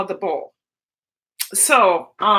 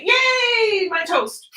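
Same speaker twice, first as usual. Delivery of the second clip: yelp; 9 semitones higher, much slower than usual. Real, not acted. Food too hot, crying out.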